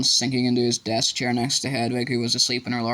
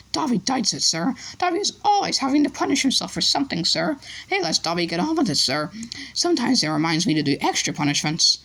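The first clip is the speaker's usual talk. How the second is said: much higher, fast elf; 9 semitones higher than usual. A narrator's reading. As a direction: chatter like a little elf. Elf matter-of-fact.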